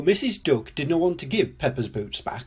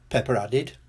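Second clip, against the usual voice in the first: ordinary delivery, nothing marked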